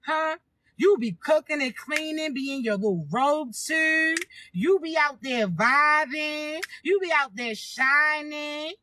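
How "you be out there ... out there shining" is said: slow, relaxed sing-song; slow vibing drawl. Acted. Talking to chosen ones about happy chores, slow dreamy drawl. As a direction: stretch it out like you're vibing at home.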